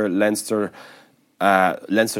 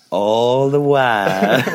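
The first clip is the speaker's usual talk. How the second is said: slow, louder drawn-out line; Will Ferrell drawl; 4 semitones higher, 7 dB louder, much slower than usual. Retold or imitated. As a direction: stretch it lazily, laughing. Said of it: "And then he just goes".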